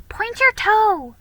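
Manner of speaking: usual speaking voice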